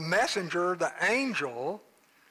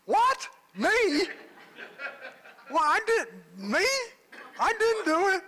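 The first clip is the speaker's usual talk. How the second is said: a sheepish husband squeals that it wasn't him; flustered denial; 12 semitones higher than usual. Acted. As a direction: play the caught husband protesting innocence. Husband's comic denial, acted quote.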